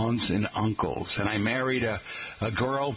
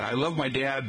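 ordinary talk

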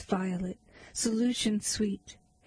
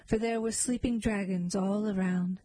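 usual speaking voice

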